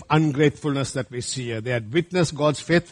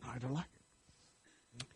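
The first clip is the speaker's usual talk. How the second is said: put-on sulky teenager; 5 semitones lower, 22 dB quieter, slower than usual. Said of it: "You tell that to your teenagers".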